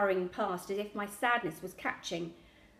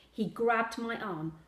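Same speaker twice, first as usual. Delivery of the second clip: usual speaking voice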